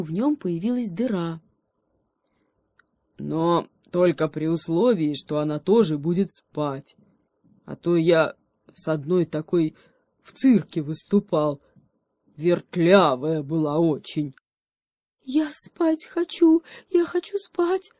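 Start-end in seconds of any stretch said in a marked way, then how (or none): 3.2-14.3 a big sleepy bear grumbling; bear speaking. A narrator's reading. Bear grumbling to the little monkey. 15.2-17.9 high, slow, trembling whine; shivering monkey complaint. A narrator's reading. Little monkey begging the bear, high trembling whine. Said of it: "дрожащим, простуженным голосом пожаловалась".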